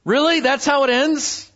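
high-pitched incredulous questioning; baffled, high disbelief; puzzled disbelief. Acted. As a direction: react as a baffled reader, voice jumping up. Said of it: "and you go".

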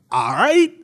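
a bold captain's eager yes, thrown out loud; captain agreeing. Retold or imitated. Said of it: "he's just like".

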